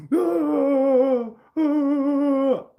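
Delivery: high falsetto wailing oh; mock sob. The sobbing neighbor during the hug, in a high wail. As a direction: wail the oh through fake tears. Crying neighbor hugging him.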